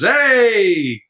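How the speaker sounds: high, excited imitation of Rob; Rob's greeting. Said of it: "like that".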